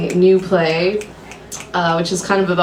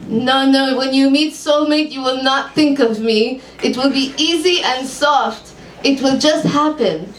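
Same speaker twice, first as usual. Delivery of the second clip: put-on lightly Hebrew-accented psychic, businesslike; 5 semitones higher than usual. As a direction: ask calmly, Hebrew accent. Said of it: "I've never done a Hebrew accent".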